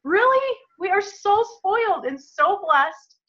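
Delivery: high, squealing amazed gratitude; overjoyed disbelief. Retold or imitated. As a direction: gasp it out in thrilled disbelief to God. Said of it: "be like".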